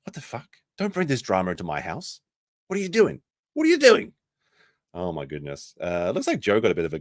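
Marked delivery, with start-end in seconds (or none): 0.1-2.2 much softer, under the breath; mock stunned mutter. Streamer imitating the host muttering in disbelief at the drama. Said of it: "He's like". 2.7-4.1 mock exasperated host. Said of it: "He's like".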